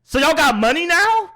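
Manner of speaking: high, loud incredulous "really"; a mock-outraged shout at rich gamers; retold disbelief. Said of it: "I'm like".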